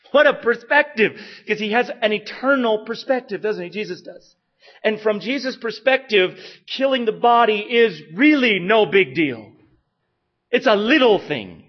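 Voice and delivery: loud, high, impassioned preaching; full-force preaching. Acted. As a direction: drive the point home with fire.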